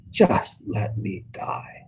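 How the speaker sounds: slow whispered plea to die; whispered despair. Acted. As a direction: breathe it out, hopeless. Despairing paraphrase of psalm.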